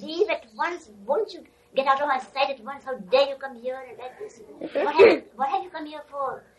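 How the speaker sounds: loud, high furious dismissal; angry shout. Retold or imitated. Guru shouting at a visitor to leave at once. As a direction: roar him out of the room. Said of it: "He abused him and shouted at him".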